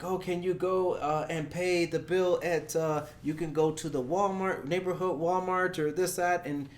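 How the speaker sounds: the pushy caller demanding details; scam caller voice. Retold or imitated. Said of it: "they're like".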